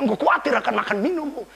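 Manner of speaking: usual speaking voice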